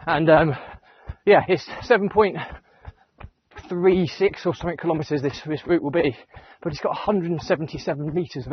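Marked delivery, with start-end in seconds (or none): none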